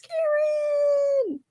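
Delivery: elated call. Real, not acted. Greeting a friend joyfully.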